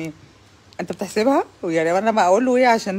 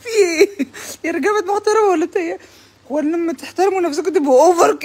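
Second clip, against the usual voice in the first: mock outrage; 6 semitones higher, 3 dB louder than usual. Mock outrage at respectful men.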